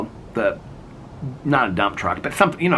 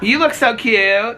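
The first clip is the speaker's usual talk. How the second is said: sweet compliment; 11 semitones higher, 4 dB louder, slower than usual. Real, not acted. Complimenting neighbor across yard.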